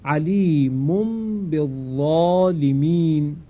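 slow Quranic chant, علیمون flowing into بالظالمین; slow tajweed reading; tajweed recitation. Shown as a demonstration. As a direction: recite slowly with the nasal m before ب. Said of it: "با هم بخوانیم".

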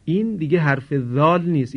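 ordinary talk

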